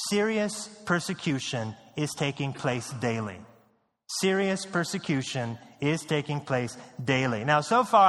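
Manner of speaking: normal talking voice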